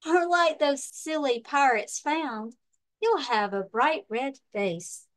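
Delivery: lively storybook read-aloud with big swings; reading to children. Acted. Librarian reading a pirate picture book to children, lively storytime voice. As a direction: read it like storytime for little kids.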